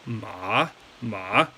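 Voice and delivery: "ma" dipping low, then bouncing up; exaggerated down-and-up "ma"; textbook third tone. Shown as a demonstration. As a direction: sink then swing "ma" upward. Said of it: "it should sound like this".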